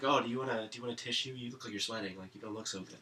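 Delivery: put-on soft, concerned little kid asking; a kind boy quietly offers a tissue; gentle kid voice. Kid next to him on the plane, softly concerned. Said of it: "the kid next to me is like".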